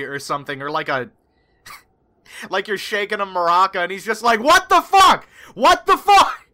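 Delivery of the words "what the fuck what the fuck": high, loud outraged yelling; pissed off. Acted. Howland shakes her like a maraca, furious yelling. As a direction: yell it in acted fury while shaking someone. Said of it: "he's pissed".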